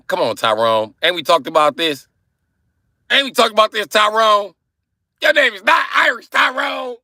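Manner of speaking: building to a high, loud shout; hammed-up exasperated yelling; exasperated scolding. Mock scolding of a viewer.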